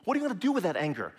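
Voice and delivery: rushed, level pressing question; quick, insistent follow-up question. Shown as a demonstration. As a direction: fire it off fast to press the point. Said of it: "What kind of result do you actually want?".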